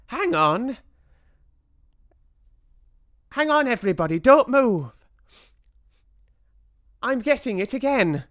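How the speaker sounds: very high, loud witch voice; Mildred crying out. A narrator's reading. Mildred catches the smell again, high urgent cry. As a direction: squeal it urgently. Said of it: "cried the witch called Mildred".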